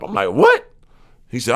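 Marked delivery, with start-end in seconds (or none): 0.3-0.7 shocked what. Retold or imitated. Reacting to insult.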